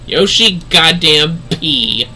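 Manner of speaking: normal talking voice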